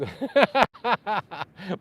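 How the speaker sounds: loud burst of real laughter; loud laughing burst; big laugh. Real, not acted. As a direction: laugh out loud, head thrown back. Big laugh at a viewer's joke.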